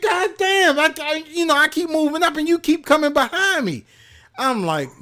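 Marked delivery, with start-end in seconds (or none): 0.0-3.8 high-pitched angry complaint; scolding the lady who keeps crowding him, pitched high; exasperated outburst. Retold or imitated. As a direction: vent the outrage up high. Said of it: "I was so fucking pissed".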